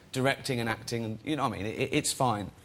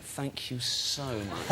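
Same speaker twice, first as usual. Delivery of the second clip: slow, softer, laughing thanks; sheepish thanks; 6 dB quieter, much slower than usual. Retold or imitated. Thanking the couple he'd scolded, laughing. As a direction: drag it out through a laugh. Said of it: "And I went".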